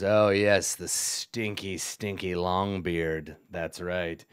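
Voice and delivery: slow, low, gloating goblin boss; Yek the goblin. Acted. Goblin boss recalls his dwarven prisoner, gloating.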